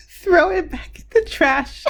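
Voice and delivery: high, slow words giving way to laughter; real laughter creeping into the voice; cracking up. Real, not acted. Cracks up again giving verdict.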